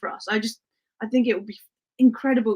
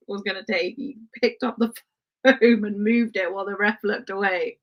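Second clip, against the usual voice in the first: ordinary talk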